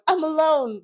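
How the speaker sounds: a high, loud, hammed-up cry of loneliness; mock Hallmark lament. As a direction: play the lonely movie heroine, overdone.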